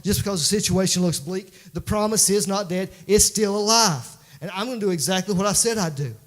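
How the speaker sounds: full-voiced sermon climax; impassioned preaching. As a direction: declare the promise to the congregation, pitched high. Preaches God's promise at full force.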